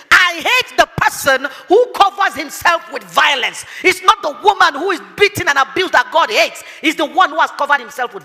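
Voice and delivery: loud, high preaching; forceful preaching. Acted. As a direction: drive the verse home from the pulpit.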